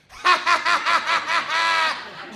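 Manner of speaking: loud, high staccato cackle; Ralphie. Retold or imitated. Headliner laughing at the stoned comic, high loud cackle. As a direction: imitate a long, uproarious laugh. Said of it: "Ralphie just looks at me and goes".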